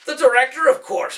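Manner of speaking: high, loud little elf; knowing little man's voice. Acted. Ben calls out the hidden director, high elf voice.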